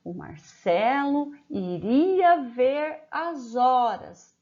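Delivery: slow, clear dictation to a class; teacher dictating a sentence word by word. Shown as a demonstration. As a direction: spell it out slowly for the pupils. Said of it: "Nós vamos escrever uma frase".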